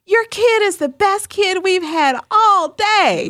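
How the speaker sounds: high, slow, drawn-out cheery gushing; gushing praise. Retold or imitated. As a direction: imitate an oblivious assistant gushing over a baby. Assistant gushes, unaware of mess.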